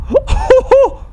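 high-pitched burst of laughter; high startled laugh. Real, not acted. Gamer seeing the game knows his messages, high laugh.

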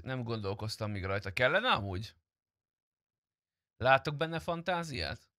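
ordinary talk

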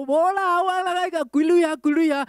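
put-on high, eager old man waving off thanks; old uncle brushing it off.

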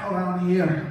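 put-on Scottish accent. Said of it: "real thick Scottish accent going here".

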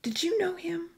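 high, hushed, breath-held question; tentative, fearful hope; anxious. Acted. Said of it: "she continued anxiously in Italian".

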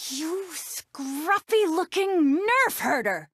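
high, slow, drawn-out outrage; indignant insult. Acted. Princess insulting Han, indignant and drawn out. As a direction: stretch the insult out, pitched high.

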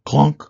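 short, hard metallic clunk; sudden clunk effect; loud imitated clunk. A narrator's reading. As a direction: drop it like a heavy part landing. Imitated gadget noises.

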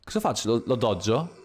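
normal talking voice

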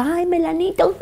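a child's amused giggle at a funny name, lightly imitated; giggling child. Mother imitating her little daughter laughing at the surname. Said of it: "se reía por el apellido".

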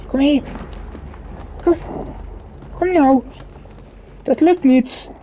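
frustrated pleading. Real, not acted.

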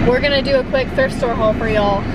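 ordinary talk, nothing marked

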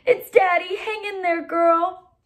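high, loud, emotional plea; choked with emotion. A narrator's reading. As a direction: urge the dog on, moved. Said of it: "his voice choked with emotion".

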